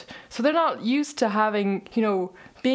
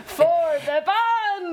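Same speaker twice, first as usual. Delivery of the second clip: high, slow, giggling Scottish crowd call; laughing Scottish hype; 8 semitones higher, much slower than usual. Retold or imitated. Singer replaying her stage call for friends, in a laughing Scottish accent. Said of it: "Her accent was really good".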